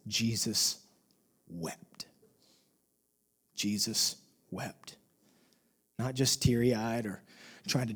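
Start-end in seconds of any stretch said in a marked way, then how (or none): 0.1-5.0 low, slow and hushed, with weighty pauses; grave, lowered voice, drawn out; solemn hush. Real, not acted. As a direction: let each word drop softly into silence. Solemn repeat of scripture's shortest verse.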